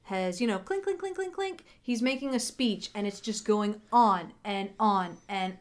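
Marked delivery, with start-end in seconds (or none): 0.6-1.6 high, soft clinks like a tapped glass; glass clinking. Retold or imitated. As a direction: tap a champagne glass with your voice. Mimicking glass tapped for a speech.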